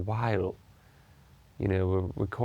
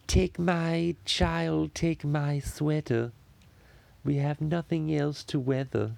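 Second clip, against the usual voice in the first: slow, high, drawn-out cartoon baby voice; Stewie voice; 5 semitones higher, slower than usual. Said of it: "sounds good in the Stewie voice".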